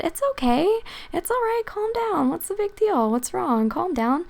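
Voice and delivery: high, soft soothing coo. Retold or imitated. Calming a raging man.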